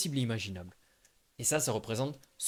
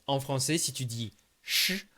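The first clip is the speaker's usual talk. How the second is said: ordinary talk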